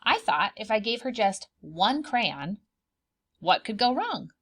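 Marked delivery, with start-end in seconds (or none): none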